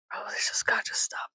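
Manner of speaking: whispered plea, hammed up; hushed, exasperated whisper; mock-desperate whisper. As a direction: whisper it like you can't take it. Quotes own despair, whispered first.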